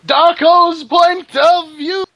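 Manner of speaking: high, slow, shouted heading; booming comic title card; mock announcement. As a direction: belt out the chapter title like a herald. Announces chapter POV heading.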